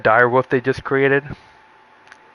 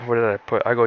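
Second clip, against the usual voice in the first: ordinary talk, nothing marked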